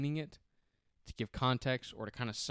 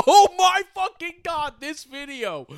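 very high, loud, drawn-out squeal; hammed-up gushing, mocking her excitement; pumped-up imitation; 13 dB louder, slower than usual. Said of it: "when you get so pumped".

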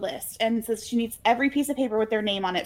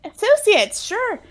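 high, loud mock-agreeing sure; a wink of sarcasm in a high voice; sarcastic agreement; 11 semitones higher, 5 dB louder, slower than usual. Real, not acted. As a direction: play along with a sarcastic sure. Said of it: "mock agreeing with correction".

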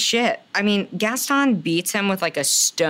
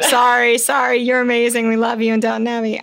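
loud apology; 4 semitones higher, 5 dB louder than usual. Real, not acted. Apologizing loudly to Dan Stevens.

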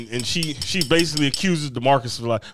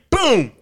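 high-pitched, punchy "boom"; slamming window noise; 9 semitones higher, 3 dB louder than usual. Retold or imitated. Storyteller acting out the window's return, sudden boom. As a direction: mimic a window banging up.

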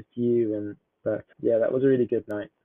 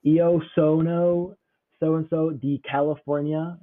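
put-on higher, louder, slow, drawn-out American accent on the Italian; 4 semitones higher, 3 dB louder, slower than usual. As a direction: imitate an American student drawling through Italian. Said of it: "these kids from America say".

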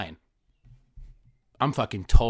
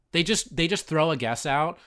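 normal talking voice

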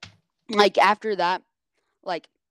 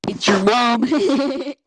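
loud, put-on cartoon voice; brash jokey cartoon catchphrase voice; mock Giggity voice; 5 semitones higher, 9 dB louder than usual. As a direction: crow the joke in a cartoon voice. Teasing joke with giggity.

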